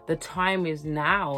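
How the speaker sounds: ordinary talk, nothing marked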